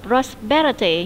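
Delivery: "Prosperity" with the stress on "per"; stress on second syllable. Shown as a demonstration. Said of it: "như vậy nó nhấn âm số hai".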